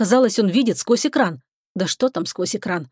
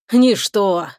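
plain reading voice

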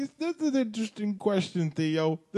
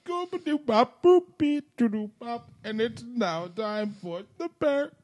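high-pitched nonsense scat, playful; Bill Cosby; 6 semitones higher than usual. Acted. Riffing after the pudding pops, high silly scat. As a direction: scat the syllables like a goofy TV dad. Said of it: "old Cosby".

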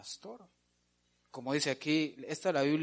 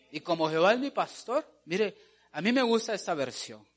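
normal talking voice

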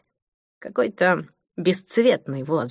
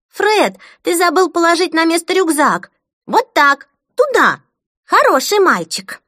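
put-on high, louder commanding child; bossy little girl; 10 semitones higher, 5 dB louder than usual. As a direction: order him around like a tiny boss. Bossy girl instructing brother.

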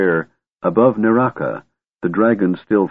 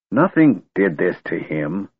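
stern. A narrator's reading. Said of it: "regarding Caramon sternly".

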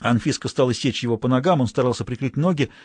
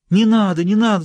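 louder, higher wail; wailing plea; 8 semitones higher, 6 dB louder than usual. A narrator's reading. Governor begging the girls, loud high wail. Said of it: "запричитал губернатор".

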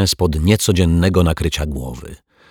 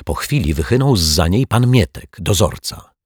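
plain reading voice

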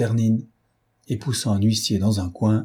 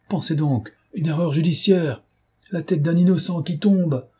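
high, impassioned appeal; mounting alarm in a raised pitch; urgent plea; 7 semitones higher than usual. A narrator's reading. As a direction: plead for an innocent man's life. Pleading to save an innocent.

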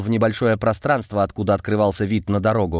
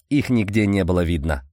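ordinary delivery

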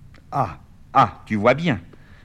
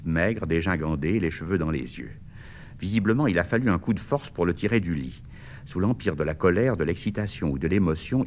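usual speaking voice

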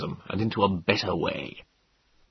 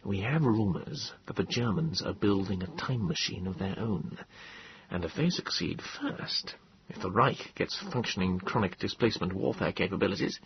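much softer, hushed and confidential; low voice; 10 dB quieter than usual. A narrator's reading. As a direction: lower your voice as if sharing a secret. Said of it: "He said in a low voice".

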